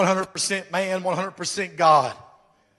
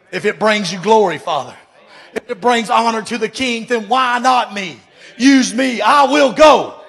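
building from firm to a shouted I will go; rising fervent prayer; 3 semitones higher, 7 dB louder than usual. Acted. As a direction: pray it up to a shout.

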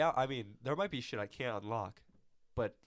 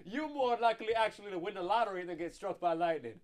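calling from far away; 7 semitones higher, 5 dB louder than usual. Real, not acted. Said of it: "I'm gonna yell over here so I don't fucking kill the mic".

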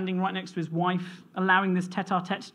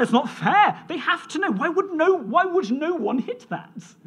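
put-on high, louder child's protest; upset child; 9 semitones higher, 5 dB louder than usual. Father imitating his son Toby's high outraged protest. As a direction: imitate a boy who is outraged it's unfair. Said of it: "It sent him mad".

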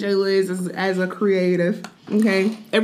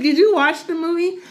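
pitched up, mocking a fan; squeaky gushing fan voice; high mock commenter; 8 semitones higher than usual. Quoting commenters.

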